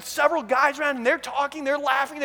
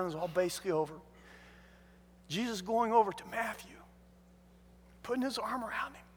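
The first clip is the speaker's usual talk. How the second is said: normal talking voice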